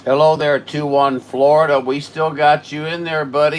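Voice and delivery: high, loud hello over the air; loud friendly radio greeting. Real, not acted. Operator greeting contact two one Florida, loud and friendly.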